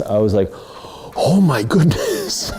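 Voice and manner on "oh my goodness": high, loud, slow gasp of disbelief; drawn-out stunned "oh my goodness"; shocked gasp. Retold or imitated. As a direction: clutch your chest in horrified surprise. Reenacting shock at the politician's request.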